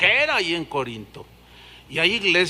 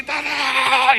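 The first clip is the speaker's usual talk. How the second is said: jackhammer noise; 4 semitones higher, 4 dB louder than usual. Retold or imitated. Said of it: "esos funcionan así".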